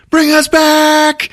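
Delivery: high, slow, loud yell up to the sky; shouting. Retold or imitated. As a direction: call it up to the heavens at full voice. Loki yells up into the sky.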